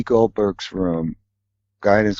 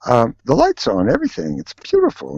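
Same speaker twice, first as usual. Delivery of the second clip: much higher, breathless delight; awestruck; 13 semitones higher than usual. Retold or imitated. Seeing his own set again and gasping in high delight. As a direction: gasp at the sight of it. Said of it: "And I said".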